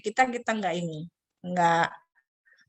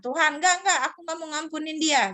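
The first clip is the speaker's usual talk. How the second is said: put-on higher insistent child; child stubbornly refusing to forgive; stubborn refusal; 7 semitones higher than usual. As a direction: dig in and refuse. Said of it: "kita ngotot sama Tuhan".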